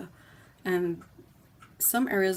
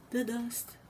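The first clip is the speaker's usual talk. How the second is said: The dust, repeated higher in mock despair; exasperated; 5 semitones higher, 3 dB quieter than usual. Griping to chat about windy-day dust, exasperated. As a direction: groan it out like dust is ruining everything.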